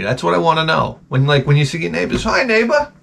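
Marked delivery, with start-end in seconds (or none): 2.2-2.9 high-pitched sugary neighbor act; mock pleasantness with irritation poking through; fake friendly. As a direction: put on a chirpy, needling neighbor voice. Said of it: "this facade of friendly".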